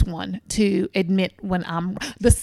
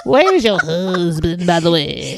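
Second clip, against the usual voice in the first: slow and lower, playful; a lightly put-on goofy voice; 5 semitones lower, 4 dB louder, slower than usual. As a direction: ask it in a silly lower voice. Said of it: "[name] is on a funny one today".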